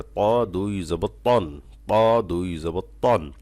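ত দুই জবর তন slow with a heavy, thickened ত; thick ta. Shown as a demonstration. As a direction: lean hard on the ত of ত দুই জবর তন. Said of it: "তটা মোটা করতে হবে".

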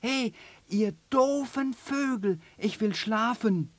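hoch und laut gerufen; rufend. Acted. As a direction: ruf genervt aus dem Fenster. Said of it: "ruft es". (verärgert rufendes Känguru)

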